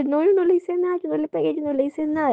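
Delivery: put-on high, insistent kid's denial; girl defending herself to her mother, high and insistent; child protesting innocence. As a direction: plead not guilty like a wronged little girl. Child denies hitting brother.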